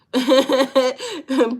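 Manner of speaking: high, loud burst of laughter; real cackle that bursts out. Real, not acted. Streamer amused by a chat joke, high loud laugh. Laughing at the chat's song reference.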